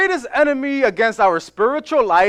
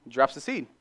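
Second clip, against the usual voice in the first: normal talking voice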